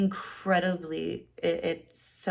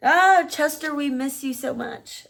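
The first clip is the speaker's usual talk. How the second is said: near tears; 8 semitones higher, 9 dB louder than usual. Real, not acted. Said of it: "I'm trying not to cry".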